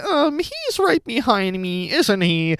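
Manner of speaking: high, slow, drawn-out parody; mock cringe meme voice. Critic imitates the actress's every second line, mock cringe voice. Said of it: "Nobody talks like this".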